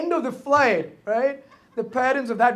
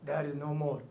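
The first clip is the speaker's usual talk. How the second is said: usual speaking voice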